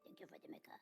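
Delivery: soft unvoiced whisper. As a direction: whisper the thanks back. Echoing thanks in whisper.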